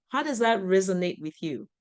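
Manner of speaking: ordinary delivery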